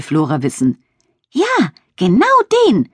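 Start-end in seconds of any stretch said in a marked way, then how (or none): 1.3-2.9 high, indignant little spider voice. A narrator's reading. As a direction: pipe up high in outrage. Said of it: "empörte sich Linne". (empörtes, hohes Stimmchen)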